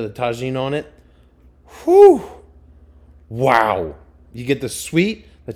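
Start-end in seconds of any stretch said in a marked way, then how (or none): 1.8-2.2 high, loud whew of delight. Real, not acted. Savoring mango with Tajin with a loud whew. 3.3-4.0 loud delighted wow. Real, not acted. Tasting mango with Tajin.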